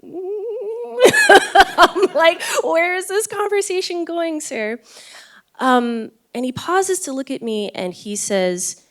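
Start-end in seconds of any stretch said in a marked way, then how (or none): 0.0-2.4 breaking into a high laugh mid-sentence. Real, not acted. Laughing while retelling surprise. 2.6-4.8 much higher, half-laughing puzzled question; amused disbelief. Retold or imitated.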